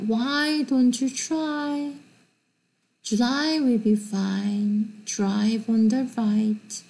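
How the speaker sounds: very slow, careful English reading; slow model sentence. Shown as a demonstration. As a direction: say it slowly for repetition. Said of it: "Listen and repeat".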